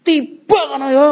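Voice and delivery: high, hammed-up cry of annoyance; comic high-pitched outburst; mock angry curse. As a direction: act out cursing a passing rider. Acts out wishing the rider falls.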